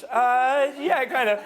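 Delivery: put-on high, awkward girly reply; guy playing the girl, awkwardly amused; girl's voice. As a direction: laugh it off, a bit embarrassed. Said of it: "same girl".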